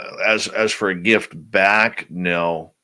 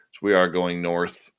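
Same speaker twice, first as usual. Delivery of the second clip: usual speaking voice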